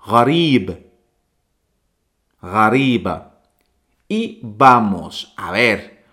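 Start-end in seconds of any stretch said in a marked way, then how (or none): 0.0-3.3 gharib then ghariba, clear throaty gh; gharib, ghariba modelled slowly; Arabic pronunciation. Shown as a demonstration. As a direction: repeat both forms for learners. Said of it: "Vuelvo a repetir".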